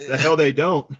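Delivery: loud retort. Real, not acted. Caller bursts in over the host with a loud retort. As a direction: blurt it out, loud and defiant.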